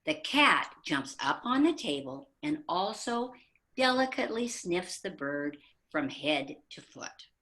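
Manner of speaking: ordinary talk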